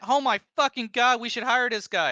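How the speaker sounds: high, loud astonished coworker voice; excited coworkers. Retold or imitated. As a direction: squeal it out like a gobsmacked colleague. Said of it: "Episode one, they were like".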